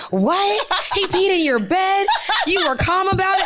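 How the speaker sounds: high, laughing disbelief; shocked friends. Retold or imitated. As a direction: squeal it back in disbelief. Friends' shocked reaction, retold.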